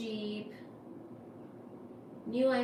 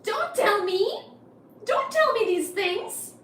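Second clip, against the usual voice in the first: high, loud, drawn-out mock protest; mock dismay; 11 semitones higher, 6 dB louder than usual. Real, not acted. Pleading with chat in loud high mock dismay. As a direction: beg them not to say it, playfully.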